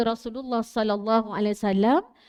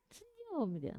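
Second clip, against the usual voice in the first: higher, very slow and gentle, with a smile; smiling demo; 8 semitones higher, 12 dB quieter, slower than usual. Shown as a demonstration. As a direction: show a sweet, unbothered smile in the voice. (senyum manis, tinggi dan perlahan)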